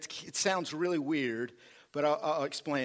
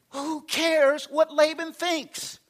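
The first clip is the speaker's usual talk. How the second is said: higher, louder and slow, full force; preached out loud; 11 semitones higher, 6 dB louder, slower than usual. Real, not acted. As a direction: ring it out over the congregation.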